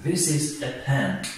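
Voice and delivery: This is a pen said very slowly, word by word; slow model sentence. Shown as a demonstration. Teacher modelling an English sentence for students, very slow. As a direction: spell it out slowly for learners. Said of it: "teacher models sentence".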